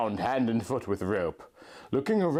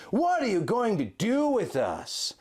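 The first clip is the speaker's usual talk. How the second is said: hatred; 9 semitones higher than usual. A narrator's reading. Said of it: "with hatred in his voice".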